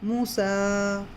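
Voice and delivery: मूसा with the ू held long, slowly; stretched vowel. Shown as a demonstration. Teacher drilling the class on a long ू in मूसा. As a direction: draw out मूसा, two counts on the ू. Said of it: "यहाँ भी दो हरकत".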